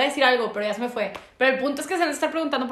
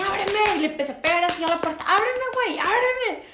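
high, urgent yelling to open up; panicked shout through a locked door; pleading shout; 8 semitones higher than usual. Retold or imitated. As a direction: pound the door and yell. Banging on bathroom door, shouting.

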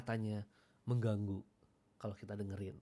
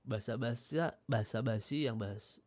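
ordinary talk

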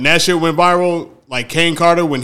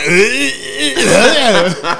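gagging ugh, higher, laughing; disgusted retching groan; 7 semitones higher than usual. Retold or imitated. Friend recalling throwing up, mock gag with a laugh. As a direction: fake a gag through a laugh. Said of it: "I was like".

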